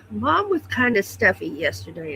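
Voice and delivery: normal talking voice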